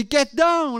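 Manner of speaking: high, urgent shouted warning; retold scream. Father warns his family under gunfire, retold scream. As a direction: imitate a father yelling a warning. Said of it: "I scream at my family".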